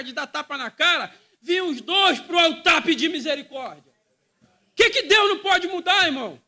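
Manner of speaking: loud, high, impassioned preacher; preaching at full force. Acted. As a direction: shout the sermon to the congregation. (pregação inflamada, em alta voz)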